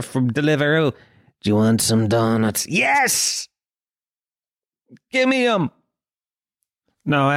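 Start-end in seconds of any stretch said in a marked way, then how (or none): 1.4-2.7 low, tempting app voice; delivery app message. Acted. Delivery app tempting a man off donuts, low and coaxing. 2.7-5.7 high-pitched, greedy yes; eager giving in. Acted. Dieter answering the donut offer, high and desperate.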